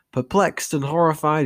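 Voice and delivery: plain reading voice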